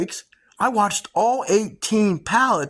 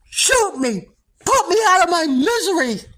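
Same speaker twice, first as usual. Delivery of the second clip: acting crazy; 10 semitones higher than usual. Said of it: "I'm just gonna act crazy".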